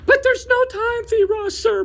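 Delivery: high, loud panicked blurt. Acted. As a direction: burst out before he can stop. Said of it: "blurted out Fiber".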